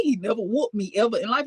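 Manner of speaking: ordinary talk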